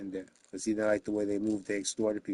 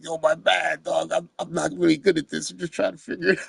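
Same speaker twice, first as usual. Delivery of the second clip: higher-pitched, lightly acted excuse; mock apology; 7 semitones higher, 4 dB louder than usual. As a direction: plead your inexperience in a raised voice. Acted apology for botched tattooing.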